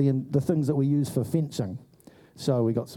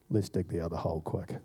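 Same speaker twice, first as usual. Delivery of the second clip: conspiratorial hush; 9 semitones lower, 8 dB quieter than usual. Retold or imitated. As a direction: lower and softer, urgent. Sneaking back after crowd leaves.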